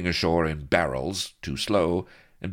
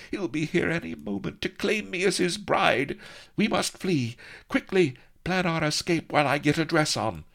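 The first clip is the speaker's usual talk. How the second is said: put-on high, urgent young lady; high anxious heroine urging flight; Vanity's voice. A narrator's reading. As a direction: pitch it high and hurry him.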